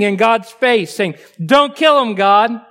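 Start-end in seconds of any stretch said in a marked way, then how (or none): 1.5-2.6 pleading cry. Acted. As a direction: beg God to spare them. Moses pleading before God.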